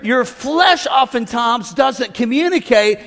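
usual speaking voice

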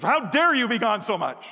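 loud pretend outburst, a joke he takes back; hammed-up yell of an angry wife; mock scolding wife. Pastor pretending his wife yelled about his absences, mock loud scolding. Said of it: "she screamed at me, she yelled at me".

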